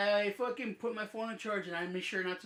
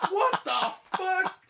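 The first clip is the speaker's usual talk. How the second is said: much higher, slow, stretched-out what; stunned disbelief; 12 semitones higher, 7 dB louder, much slower than usual. Retold or imitated. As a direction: draw it out in shocked disbelief. Stunned reaction in retold story.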